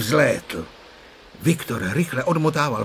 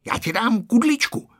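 higher, tempting promise; high-pitched coaxing offer; promising; 10 semitones higher than usual. A narrator's reading. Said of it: "sliboval Ferda".

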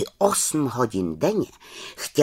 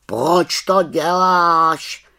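loud, drawn-out raven voice; raven character, questioning; 6 semitones higher, 8 dB louder, much slower than usual. A narrator's reading. Raven questions the little witch's prank, loud and drawn-out. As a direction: stretch the question out, high and loud.

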